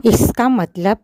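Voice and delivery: loud, indignant outburst; the mouse angrily confronting the shopkeeper; angry mouse. Acted. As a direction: raise your voice in anger. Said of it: "चूहा गुस्से में बोला".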